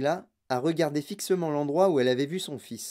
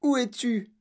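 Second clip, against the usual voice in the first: anguished call; 8 semitones higher than usual. Acted. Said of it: "hurla madame Tivy en se tordant les mains".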